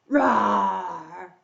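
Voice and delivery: drawn-out play lion roar. Pretend. Storyteller plays the lion for young listeners, drawn-out roar.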